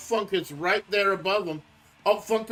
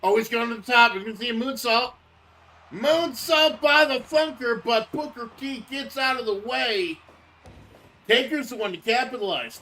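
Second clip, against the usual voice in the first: hyped, higher play-by-play call; wrestling commentator; 5 semitones higher, 3 dB louder than usual. Acted. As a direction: ringside announcer building excitement. Live play-by-play of the match.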